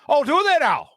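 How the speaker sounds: Irish guy. Retold or imitated. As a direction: tease her like a cheeky Irish barman. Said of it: "He goes".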